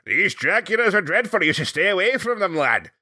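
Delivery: lightly voiced old Scotsman, high and bright; a hint of a fussy Scottish elder; mock Scottish old man. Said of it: "you made him the old man who's just like".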